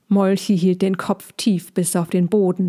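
usual speaking voice

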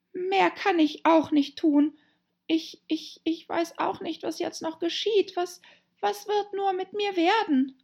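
high, plaintive little-heroine voice; worried girl Alice. Acted. Trapped Alice wondering what will become of her, high and worried. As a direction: fret aloud in a high voice, with halting repeats.

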